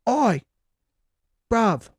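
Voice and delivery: a playful London "Oi, bruv"; British accent. Pretend. Channelling Ted Lasso to his viewers with a mock British accent. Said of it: "so I've been speaking British".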